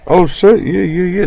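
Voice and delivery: startled excited shout; excited outburst. Real, not acted. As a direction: blurt it out at the chart move. Sudden market move, exclaiming.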